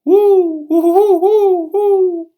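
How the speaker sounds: very high loud owl hoots with a laugh in them; owl call back. Acted. Pa answering the owl, high loud hoots with a laugh. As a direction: answer the owl like friends chatting. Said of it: "Then he called back".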